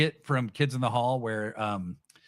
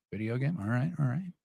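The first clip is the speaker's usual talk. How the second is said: ordinary talk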